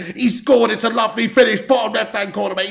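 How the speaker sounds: high, loud disbelief; cursing turns into excited commentary; exasperated goal call. Real, not acted. Streamer calling Messi's lovely finish, agitated and high. Goal against his hopes, live reaction.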